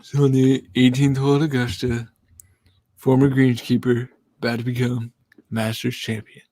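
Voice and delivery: a light Bill Murray golf-announcer hush; lightly voiced Caddyshack narration; Bill Murray impression. Impression for the stream chat, lightly voiced Bill Murray. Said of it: "we can do the Bill Murray impression".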